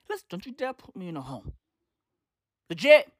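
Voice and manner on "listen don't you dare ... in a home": quick, hushed, low warning; quiet mock menace to the kids; restrained warning. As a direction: rein it in to a soft, serious threat. Same warning, reined in.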